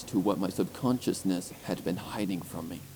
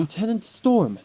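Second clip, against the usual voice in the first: a sharp high shout across the dungeon; calling out; 10 semitones higher, 6 dB louder than usual. A narrator's reading. Guard calling out to Lieutenant Storm, high loud shout. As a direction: call her name loud and high. Someone calls out her name.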